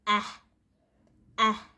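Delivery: एह as a short open eh, said alone; phonic eh sound. Shown as a demonstration. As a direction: clip एह short like the e in egg. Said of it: "इसको बोलना है".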